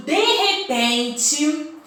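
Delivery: de repente stretched slow for suspense; suspenseful de repente. Shown as a demonstration. Teacher modelling a dramatic de repente for pupils. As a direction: draw out de repente to build suspense. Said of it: "façam esse de repente ser muito especial".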